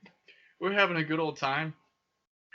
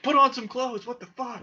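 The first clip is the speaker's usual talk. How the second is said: much higher, louder shocked yell; exasperated shout; 10 semitones higher, 4 dB louder than usual. Retold or imitated. Telling the hoochie mama's men to dress, shocked yell. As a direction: shout it in disbelief. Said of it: "I was like".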